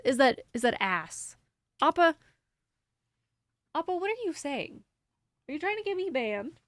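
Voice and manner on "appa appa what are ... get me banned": talking to the dog. Pretend. Scolding the dog on screen.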